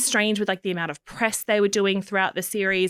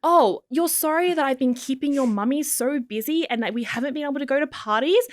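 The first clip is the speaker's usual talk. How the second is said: put-on higher cooing baby talk; 7 semitones higher, 3 dB louder than usual. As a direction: coo to the shoe like a toddler. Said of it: "talk to your shoe in a baby voice".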